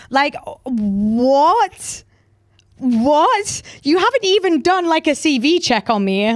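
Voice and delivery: real outraged disbelief; incredulous what. Real, not acted. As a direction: pitch climbing in disbelief.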